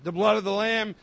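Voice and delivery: loud, forceful pulpit plea; impassioned preaching. Acted. Preacher voicing Moses' plea for his people, impassioned and loud. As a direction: plead with God at preaching volume.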